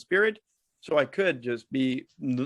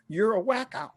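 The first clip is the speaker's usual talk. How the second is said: higher, playful jab: You're a wacko; teasing nurse; 7 semitones higher than usual. Retold or imitated. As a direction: call him a wacko in a teasing tone. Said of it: "They're like".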